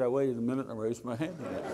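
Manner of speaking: fast, laughing through the line; laughing retelling. Retold or imitated. Proud father laughing while retelling his daughter's stubbornness. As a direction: chuckle at her stubbornness as you tell it.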